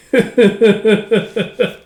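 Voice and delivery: amused laugh. Real, not acted. Laughs at braided horse mane.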